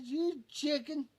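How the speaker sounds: high, slow teasing jeer; retold high teasing call; mocking taunt. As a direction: drag it out to taunt him. Teasing scared friend.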